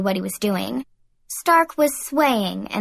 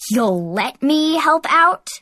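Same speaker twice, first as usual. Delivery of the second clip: higher, slow, incredulous challenge; indignant snap; 5 semitones higher, 4 dB louder, slower than usual. A narrator's reading. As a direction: throw it back as an indignant question. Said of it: "Heath snapped".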